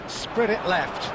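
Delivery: ordinary talk, nothing marked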